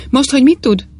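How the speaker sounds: ingerült kérdés; irritated. A narrator's reading. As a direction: demand it irritably.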